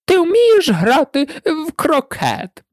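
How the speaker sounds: slow, high-pitched demand; drawn-out shrill royal question; shouting queen. A narrator's reading. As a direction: call it out slowly and imperiously. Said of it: "крикнула королева".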